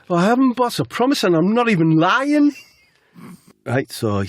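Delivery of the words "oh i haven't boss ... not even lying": very high, loud comic protest; hammed-up high-pitched denial; Jack. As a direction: plead innocence in a squealing put-on voice. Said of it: "Jack says".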